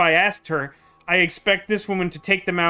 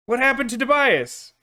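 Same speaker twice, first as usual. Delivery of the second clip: acted question; 7 semitones higher than usual. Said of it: "and it'd be like".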